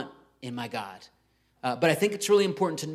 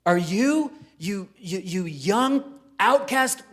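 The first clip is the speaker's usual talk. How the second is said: louder and higher, slow sneering outcast; indignant Pharisee; 5 semitones higher, 5 dB louder, much slower than usual. Acted. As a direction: voice the offended leaders looking down on him. Pharisees challenge Jesus.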